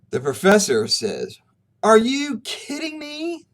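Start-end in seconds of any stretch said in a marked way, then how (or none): 1.8-3.5 high, slow, stunned outrage, retold; a retold disbelieving cry, raised high; freaking out. Professor to the dean, a retold high disbelieving outburst. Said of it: "and freaks out".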